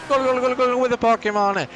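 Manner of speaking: high, excited rally cry; playful cheering. Pretend. Commentator cheering on the Pokemon mid-race, playful cheering. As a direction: urge them on from high pitch, sliding down.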